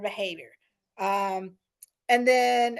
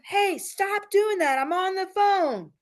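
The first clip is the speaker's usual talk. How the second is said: high, slow, exasperated mock scold; mock yell at child; 8 semitones higher, slower than usual. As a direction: snap at the kid like an annoyed parent on a call. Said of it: "you yell at them".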